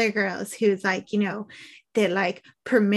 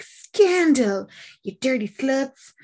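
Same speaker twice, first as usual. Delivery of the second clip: higher, hammed-up outrage; mock scandalised; 4 semitones higher, slower than usual. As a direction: imitate a shocked congregation. Imitates congregation's shocked outrage.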